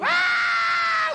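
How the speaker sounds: sudden loud high-pitched scream; mock scream. Pilot imitating his screaming passengers in the loop, loud mock scream. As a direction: let out a piercing mock scream. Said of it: "you fucking scream all the fucking time".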